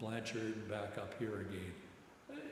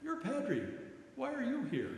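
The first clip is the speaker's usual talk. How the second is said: put-on puzzled young soldier; 13 semitones higher, 3 dB louder than usual. Medic asks the chaplain.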